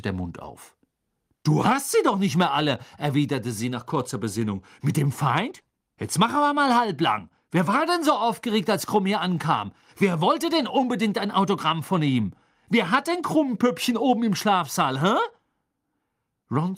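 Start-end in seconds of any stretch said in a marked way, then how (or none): 1.4-2.8 higher, louder comeback; indignant retort. Acted. Hermine retorts in the quarrel. 4.8-15.2 high, needling rhetorical questions; heated retort. Acted. Hermine throws accusations back at Ron.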